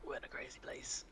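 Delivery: hushed whisper to the party; whispered aside. Real, not acted. Player whispering to the table about the crazy shop. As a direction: lean in and whisper it. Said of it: "whispered aside".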